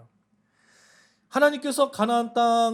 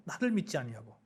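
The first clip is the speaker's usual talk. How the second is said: "나를 믿지 아니하고" repeated lower and much softer; quiet repeat; 6 semitones lower, 8 dB quieter than usual. Acted. Preacher echoing God's words to the congregation, quietly and lower. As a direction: let the rebuke sink in, lower and hushed. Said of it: "이렇게 말씀하거든요".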